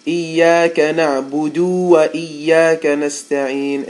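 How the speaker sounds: نعبد with the dal dragged out long; stretched dal mistake. Shown as a demonstration. Teacher demonstrating the mistake of a stretched dal. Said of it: "so many people say".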